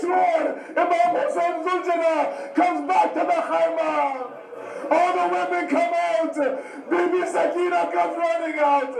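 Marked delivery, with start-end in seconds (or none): none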